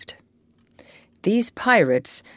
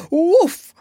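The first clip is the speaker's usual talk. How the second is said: higher, louder barking woof; louder higher yelping woof; 11 semitones higher, 7 dB louder than usual. A narrator's reading. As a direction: bark loud enough to wake himself. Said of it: "he barked loud".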